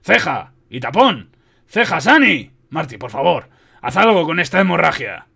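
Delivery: loud surgeon calling out to helpers; forceful, pressing demands; urgent orders. A narrator's reading. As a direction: bark orders mid-operation.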